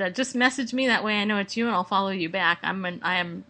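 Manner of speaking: normal talking voice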